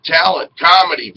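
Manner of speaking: ordinary talk, nothing marked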